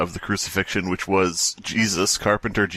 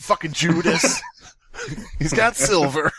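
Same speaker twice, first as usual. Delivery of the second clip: mock Jesus; 7 semitones higher than usual. Jesus griping at last supper.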